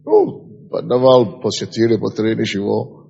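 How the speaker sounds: ordinary talk, nothing marked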